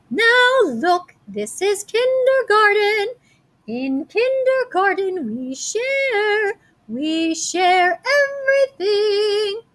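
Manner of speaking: high, slow kindergarten teacher voice, drawn out; sing-song teacher. Acted. Teacher scolding the fighting kids, high and sing-song. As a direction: preach sharing like a cheery kindergarten teacher.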